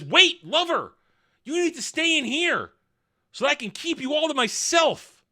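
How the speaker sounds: high, lilting mock lover; Sauron. The villain keeping Celebrimbor inside as a lover, mock cooing. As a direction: plead possessively in a high put-on voice. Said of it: "here comes Sauron and says".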